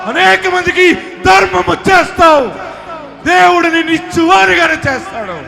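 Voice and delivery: preacher proclaiming abundance to the crowd, shouting; preaching at full force. Acted. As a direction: shout the victory to the hall. Preacher prophesies blessing loudly.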